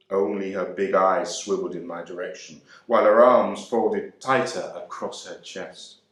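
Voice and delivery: usual speaking voice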